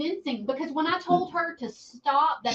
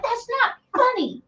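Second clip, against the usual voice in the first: high-pitched, indignant retort; retold mad; 12 semitones higher, 4 dB louder than usual. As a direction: re-enact a mother scolding her joking daughter. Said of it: "I was so mad".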